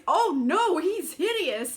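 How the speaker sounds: mock a creator recoiling; overdone horrified oh no; mock horror. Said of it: "before he realized".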